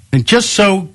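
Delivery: high-pitched, put-on gush; mock perky gushing. Radio host teasing about a cute pop star, mock gushing. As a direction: gush with exaggerated perkiness.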